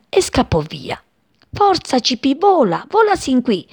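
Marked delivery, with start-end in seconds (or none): 1.5-3.7 mother calling. A narrator's reading. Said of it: "gridava la sua mamma".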